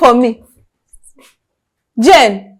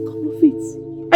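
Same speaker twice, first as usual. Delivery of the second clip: whispered plea; 11 dB quieter, much slower than usual. Acted. Sister softly begs her sibling, whispering. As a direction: Come off it breathed unvoiced, slow and soft.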